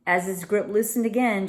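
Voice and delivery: usual speaking voice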